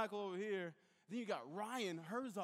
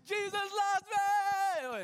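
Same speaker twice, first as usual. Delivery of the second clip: much higher, much louder, slow yelled battle cry; 14 semitones higher, 13 dB louder, much slower than usual. Retold or imitated. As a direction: charge forward bellowing it. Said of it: "yelling random stuff at him".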